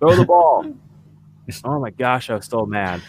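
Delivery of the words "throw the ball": high, loud yell; shout. Real, not acted. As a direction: yell it at the screen. Yelling at the team's play call.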